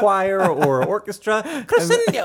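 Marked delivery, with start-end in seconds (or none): 1.7-2.2 very high villain shriek; Voldemort. Retold or imitated. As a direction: shriek it like a wizard conductor. Voldemort conducting a choir.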